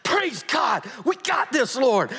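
high, loud jubilant shout; high, loud joyful shout; triumphant. Retold or imitated. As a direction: crow with delight. Preacher exults in scene.

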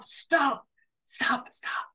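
whispered, whimpering re-enacted horror; acted-out squeamish begging, fading away; panicked pleading. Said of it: "I was going".

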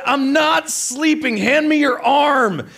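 high, loud, drawn-out insistence; acted yelling. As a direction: shout it like an indignant half-asleep husband. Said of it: "You are screaming".

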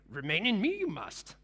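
a hint of Yoda, barely changed voice; half-hearted Yoda voice; terrible Yoda impression. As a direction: lightly mimic Yoda's backwards wisdom. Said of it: "That's a really terrible Yoda impression".

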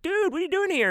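retelling his own words from a dream in a high squeak; high squeaky voice. Retold or imitated. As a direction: jump way up into a cartoonish squeak. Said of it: "and I was like".

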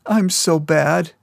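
slow, high tearful wail; crying. A narrator's reading. Crystal crying to Keo that she is bad. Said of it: "Crystal cried".

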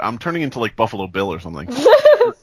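usual speaking voice

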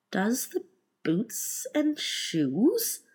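slow, high, puzzled question rising at the end; wondering. A narrator's reading. Puzzled Alice repeats the Griffin's words, wondering. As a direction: echo it haltingly in wonder. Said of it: "she repeated in a wondering tone".

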